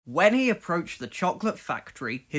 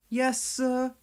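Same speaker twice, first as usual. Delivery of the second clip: meek; 8 semitones higher than usual. Acted. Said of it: "replied Percy".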